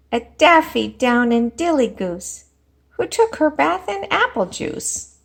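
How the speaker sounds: a teacher performing a rhyming picture book; storybook read-aloud. Acted. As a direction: read it out big and playful for the class.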